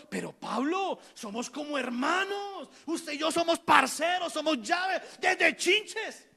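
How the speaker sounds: lightly voiced friend begging, breaking into a laugh; a mock plea from an old buddy; pleading Bernabé. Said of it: "me imagino a Bernabé diciéndole a Pablo".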